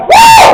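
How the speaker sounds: very high, loud party "woo"; hammed-up celebratory scream; whooping cheer. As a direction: let out a piercing drinker's whoop. Acting out drunken celebration.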